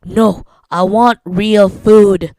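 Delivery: loud, slow, demanding; imitating a stubborn partner; mock demand. Partner quoted refusing Filipino food.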